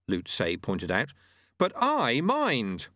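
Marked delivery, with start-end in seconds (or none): 1.6-2.9 high, drawn-out objection; hot protest. A narrator's reading. Student refusing to behead the willing yeti, hotly. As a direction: protest hotly, pitched up and slow. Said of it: "said Lobsang hotly".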